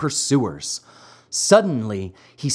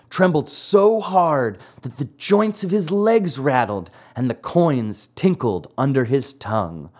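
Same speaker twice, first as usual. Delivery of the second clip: ordinary delivery, nothing marked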